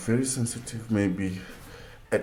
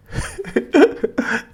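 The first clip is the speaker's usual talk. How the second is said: high loud laugh breaking out; can't hold the laugh in; burst of laughter; 6 dB louder than usual. Real, not acted. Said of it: "I'm trying to not to laugh".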